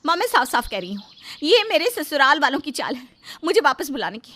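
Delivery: Rita raising her voice defiantly; acted angry outburst to her mother; shouting in protest. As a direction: build to a loud, stubborn refusal. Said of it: "Rita चिल्ला रही थी".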